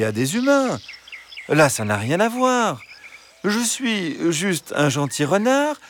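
high, sweet-talking fox voice; gentle fox. Acted. Fox arguing with wary hens to get in, gentle. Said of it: "Le renard reprit".

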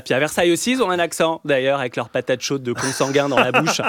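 usual speaking voice

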